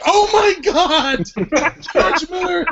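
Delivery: high-pitched, drawn-out tearful lament; hammed-up weepy outburst; mock tearful wail. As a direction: wail it in exaggerated heartbreak, laughing through it. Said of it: "made her cry".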